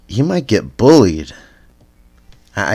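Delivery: ordinary talk, nothing marked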